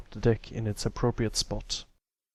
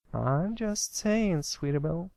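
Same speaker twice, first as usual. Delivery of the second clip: high, slow drawled filly voice; drawling filly; 8 semitones higher than usual. Acted. Applebloom teasing Sweetie Belle in a high, slow drawl. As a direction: stretch it out teasingly. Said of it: "Applebloom drawled out".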